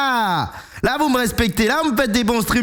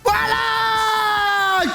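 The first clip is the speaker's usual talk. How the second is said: triumphant shout; 6 semitones higher than usual. Real, not acted. Celebrating a big win.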